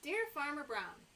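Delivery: ordinary talk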